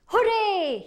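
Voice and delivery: loud, high cheer; shout. Acted. Hanahito cheering after trapping the creatures, a loud high hooray. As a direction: cheer out with all your joy. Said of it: "he shouted".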